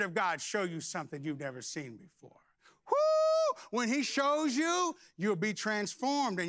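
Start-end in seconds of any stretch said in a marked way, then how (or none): 2.9-3.6 joyful preacher whoop; a high cry of joy; high loud woo. Real, not acted. Preacher whooping at his congregation.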